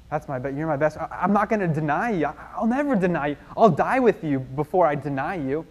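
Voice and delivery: acted-out indignant promise of loyalty; earnest protest. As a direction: swear it urgently, stumbling over the first words. Peter vows loyalty to Jesus.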